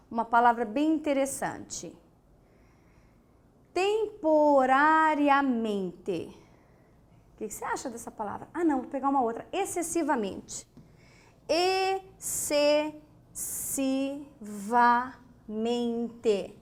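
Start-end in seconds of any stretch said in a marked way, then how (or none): 3.7-6.3 drawn out syllable by syllable; slow syllables. Shown as a demonstration. Teacher spelling it out slowly for the class. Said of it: "teacher says word slowly". 11.5-16.6 Excessivamente stretched out very slowly, each syllable held. Shown as a demonstration. Teacher stretching Excessivamente slowly for the class. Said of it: "Palavra interessante".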